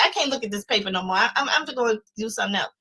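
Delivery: grumbling at the paper, rushed; a student sick of her paper, muttering quickly; fed-up muttering. Retold or imitated. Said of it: "I'd be like".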